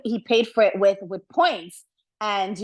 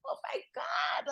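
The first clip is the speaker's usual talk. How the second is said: whispered, stunned oh my God; breathless shock at the gossip; 12 dB quieter than usual. Real, not acted. Hearing the juicy detail, a stunned whisper.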